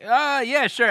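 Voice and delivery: high doubtful yeah sure; skeptical. Retold or imitated. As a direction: play along unconvinced. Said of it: "And I'm like".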